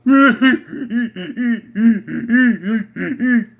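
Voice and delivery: a hammy stage laugh in character; mock showman laugh. Comic host laughing as [name], hammed-up. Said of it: "my [name] imitation of [name]".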